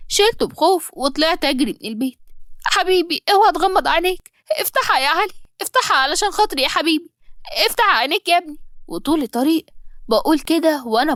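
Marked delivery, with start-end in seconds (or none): 2.6-8.6 desperate pleading. Acted. Begging unconscious child.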